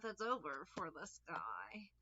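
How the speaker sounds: slow, soft whisper; whispered verdict. Acted. Tarot reader pronouncing the Death card, whispered. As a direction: whisper it like a doom sentence.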